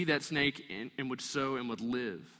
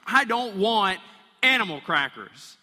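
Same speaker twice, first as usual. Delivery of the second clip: put-on kid's loud protest, higher and louder; loud refusal; 6 semitones higher, 9 dB louder than usual. Kid in the back seat shouting at dad, loud protest. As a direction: yell it like a kid refusing a snack. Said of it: "But it sounds more like this".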